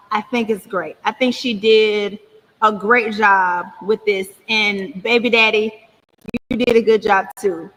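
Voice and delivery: slow, dragged, distorted audio; words stretched like a slowed tape; slowed playback. Real, not acted. [name]'s clip playing slowed down on the stream. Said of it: "Not sure why it's this fast".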